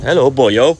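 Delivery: loud mock South Welsh accent; Swansea accent. Shown as a demonstration. Streamer showing a viewer the South Walian accent, mock Swansea. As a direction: put on a punchy Swansea lilt. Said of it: "like a Swansea type accent?".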